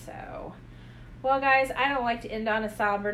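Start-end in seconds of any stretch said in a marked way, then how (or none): 0.0-0.5 hushed, breathy whisper of grief. Real, not acted. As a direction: barely voice the word. Echoing sad news softly.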